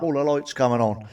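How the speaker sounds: ordinary talk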